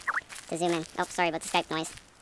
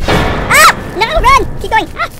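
high, loud panicked scream; real terrified shriek while fleeing in a horror game; 8 dB louder, slower than usual. Real, not acted. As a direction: shriek and yell at them to run. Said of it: "Girly screams".

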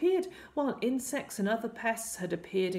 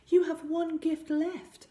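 high, slow, louder queen's voice; queen mother; 11 semitones higher, 5 dB louder than usual. A narrator's reading. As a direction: announce it high and slowly as the mother. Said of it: "said his mother, the Queen".

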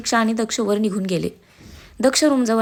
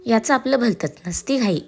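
usual speaking voice

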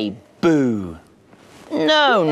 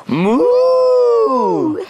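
long, high, loud moo; cow mooing; 5 dB louder than usual. Acted. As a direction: let out a big swooping moo. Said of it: "That's what a cow says".

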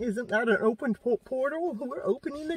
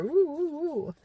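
excited whoops; 7 semitones higher than usual. Retold or imitated. Cheering the excitement.

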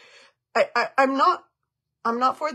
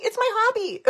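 cheeky retort; 13 semitones higher than usual. Retold or imitated. Joking reply to her son.

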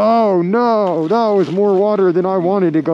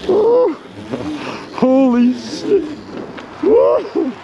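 startled gasps; 7 semitones higher, much slower than usual. Real, not acted. Sliding on snowy trail.

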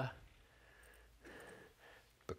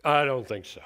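defiant retort; 6 dB louder than usual. Retold or imitated. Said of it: "the question that says".

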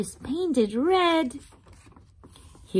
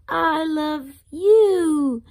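tender; 6 semitones higher, 6 dB louder, much slower than usual. Acted. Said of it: "And it says".

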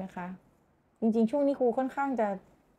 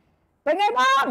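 high, loud and slow booming greeting; roar demo; 6 dB louder, slower than usual. Shown as a demonstration. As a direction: bellow the greeting. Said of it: "อย่างเงี้ย". (คำรามทักทายเสียงสูงดังช้า)

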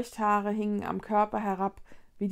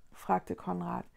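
ordinary delivery, nothing marked

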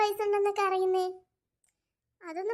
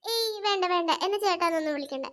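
normal talking voice

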